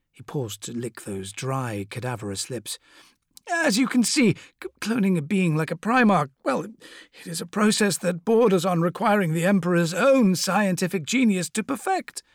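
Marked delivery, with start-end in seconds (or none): 3.5-12.2 Fabius voice. Acted.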